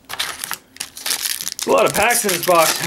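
breathless and slowed down; out of breath. Real, not acted. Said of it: "I'm running out of breath".

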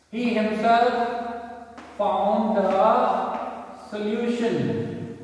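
very slow, stretched-out words; dictating. Shown as a demonstration. Teacher giving children an example sentence, slow dictation. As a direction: draw out the example as if writing it.

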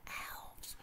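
soft awed whisper. Acted. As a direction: breathe the word in hiding. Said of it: "whispered Joe".

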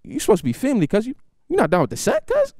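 twitchy challenge; suspicious gangster uncle. Retold or imitated. Twitching uncle questioning nephew's loyalty, high-pitched. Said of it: "He goes".

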